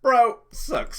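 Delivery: very high, loud triumphant cry; elated gamer exclamation; thrilled. Real, not acted. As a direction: burst out celebrating the roll. Player celebrates a successful roll.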